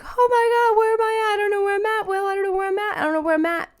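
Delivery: high, tearful panicked pleading; retold crying panic, voice way up. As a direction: sob it out, lost and scared. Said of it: "so I'm crying now and I'm like".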